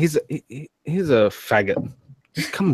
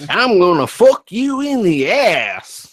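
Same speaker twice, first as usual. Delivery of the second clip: slow, loud, high mock American drawl; pretend American accent; 7 semitones higher, 5 dB louder, slower than usual. Streamer parodying Americans for laughing friends, exaggerated American accent. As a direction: stretch it out in a hammed-up American accent. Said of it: "I'll pretend to be American".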